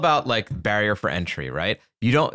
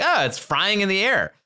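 high, silly marketer voice; mock marketing voice; 8 semitones higher than usual. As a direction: squeak it like a cheesy ad.

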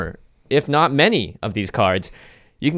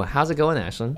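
ordinary talk

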